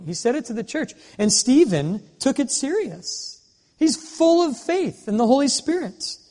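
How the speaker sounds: rising, urgent sermon call; preaching. Acted. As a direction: build it into a fervent charge to the congregation.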